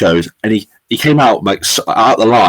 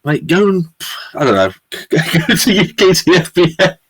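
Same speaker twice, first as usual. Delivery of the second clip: laughing mock rant; 9 semitones higher than usual. Mocking the champion, laughing.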